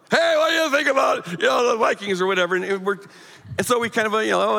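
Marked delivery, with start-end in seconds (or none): 0.1-2.5 sudden loud shout, pitched high; blurted shout. Retold or imitated. Deaf father blurting to family over football, loud shout. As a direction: blurt it out at the top of your voice. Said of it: "he'd blurt out something totally irrelevant".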